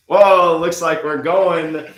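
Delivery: high, loud, slow delighted whoa; excited whoop. Acted. Nice lady led off by the suited stranger, loud delight. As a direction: burst out in delight as you're swept off.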